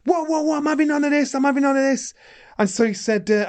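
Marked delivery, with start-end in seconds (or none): 0.1-2.1 high-pitched frantic refusal; retold panicked protest. Hit sparring partner quitting on the spot, panicked. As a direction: mimic a rattled man calling it off. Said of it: "And he went".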